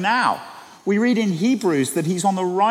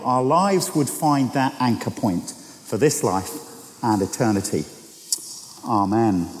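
prayer; 7 semitones lower than usual. Acted. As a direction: pray it gently and steadily. Opening prayer in sermon.

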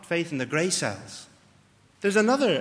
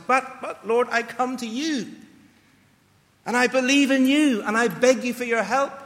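pleading prayer; 5 semitones higher, 3 dB louder than usual. Retold or imitated. Man's prayer voiced in sermon.

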